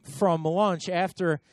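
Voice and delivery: normal talking voice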